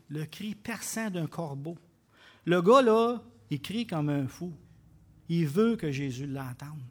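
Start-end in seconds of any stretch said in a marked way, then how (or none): 0.1-1.8 softer, slow, with a rise on perçant; 1 quiet restatement. Real, not acted. Preacher repeating the Greek meaning, quiet and slow. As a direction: say it low and deliberate.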